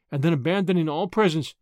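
plain reading voice

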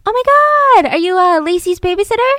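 high, loud, gushing surprise; mock surprised stranger. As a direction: play the nosy stranger, shrill with shock. Stranger approaches at park.

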